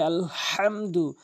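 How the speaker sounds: আলহামদু, slow, হা from mid-throat; correct Alhamdu. Shown as a demonstration. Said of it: "এভাবে আমরা উচ্চারণ করব". (আলহামদু ধীরে, হলক থেকে চাপ দিয়ে)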